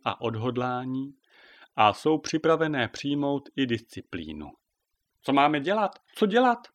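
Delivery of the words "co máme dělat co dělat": high, loud panicked questions; flustered, confused. A narrator's reading. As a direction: ask in a rising fluster. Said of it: "Zajíkly se a pobíhaly zmateně a rozrušeně". (zmateně a rozrušeně)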